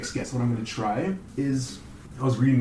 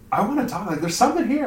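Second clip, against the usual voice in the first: eager attraction; 9 semitones higher, 4 dB louder, faster than usual. Acted. Acting out attraction at the gym.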